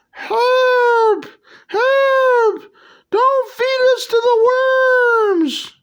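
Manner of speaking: very high squeaky cry for help; worms crying for help. Acted. Worms begging the heroes, very high squeaky plea. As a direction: shriek it like tiny cartoon worms. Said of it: "So you gotta say it like".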